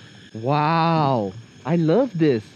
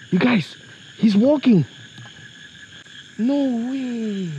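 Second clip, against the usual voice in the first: excited; 2 semitones higher, 3 dB quieter than usual. Real, not acted. First sight of the walking rhino.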